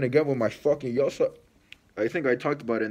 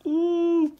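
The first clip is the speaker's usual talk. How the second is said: high drawn ooh of awe; impressed ooh; 5 dB louder than usual. Real, not acted. Vlogger cooing at the sneakers, a high ooh. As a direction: coo over the shoes.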